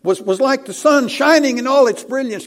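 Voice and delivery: breathless awe climbing to full voice; tumbling out. Acted. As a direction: build from awe to a near shout of wonder. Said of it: "Can you just hear the words tumbling out?".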